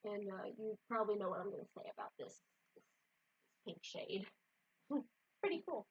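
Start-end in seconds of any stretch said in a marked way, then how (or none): none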